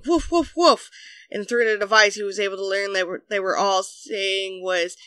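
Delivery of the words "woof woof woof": high-pitched imitation woofs; yappy put-on dog barks; mock dog barking. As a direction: bark like an excited dog. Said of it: "all the neighborhood dogs were screaming".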